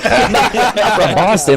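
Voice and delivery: laughing retort. Retold or imitated. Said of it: "I'm like".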